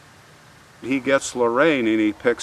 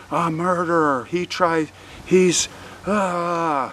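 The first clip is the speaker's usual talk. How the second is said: high, breathless imitation of a panicked woman; mock screaming; 7 semitones higher, slower than usual. Woman crying out to police about her attacker, mock screaming. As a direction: act out frantic, broken cries without full volume. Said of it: "they see this woman screaming with a gun".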